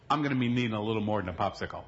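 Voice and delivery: quick line into a laugh; laughing reply. Retold or imitated. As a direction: say it fast, then laugh. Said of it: "she smiled at me".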